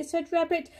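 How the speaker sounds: plain reading voice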